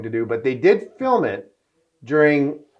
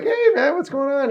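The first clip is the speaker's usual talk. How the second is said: much higher, bright hail to a star; excited greeting; 14 semitones higher than usual. Retold or imitated. Greeting Cuba Gooding Junior.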